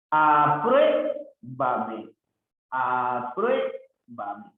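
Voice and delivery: Apruébame very slow, with a long stressed "prué"; sounded-out stress. Shown as a demonstration. Teacher showing an esdrújula word, Apruébame drawn out. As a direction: stretch Apruébame syllable by syllable, leaning on "prué".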